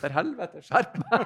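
high, mock-startled curse; a driver caught out, hamming it up. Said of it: "så bare". (hammed-up, høy og forskrekket sjåfør)

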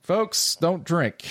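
high-pitched, put-on address to the crowd; mock public warning. Comedian warning listeners after a puking story, mock public-service plea. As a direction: announce it like a joking cautionary message.

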